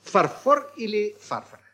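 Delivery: Фарфор first stressed on FOR, then фарфор on FAR; contrasting stresses. Shown as a demonstration. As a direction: offer far-FOR or FAR-far for Фарфор или фарфор. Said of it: "как правильно".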